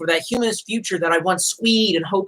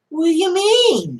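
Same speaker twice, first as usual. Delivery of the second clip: mock confusion; 9 semitones higher, slower than usual. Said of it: "They were like".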